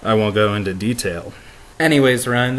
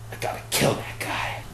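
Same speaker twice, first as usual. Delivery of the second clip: inner thought; 7 dB quieter than usual. Retold or imitated.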